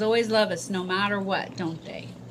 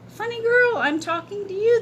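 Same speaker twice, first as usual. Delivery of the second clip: put-on higher, playful boy; grinning boy voice; 12 semitones higher, 5 dB louder than usual. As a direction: tease like a grinning kid. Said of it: "the boy said with a grin".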